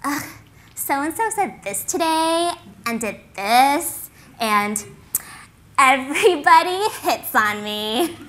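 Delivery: high, drawn-out mock whine of a schoolgirl; fake complaining. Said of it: "they'd complain, but you knew most of it was fake".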